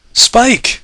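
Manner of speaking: high loud frantic call. Acted. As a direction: call out desperately. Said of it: "she called out again and again".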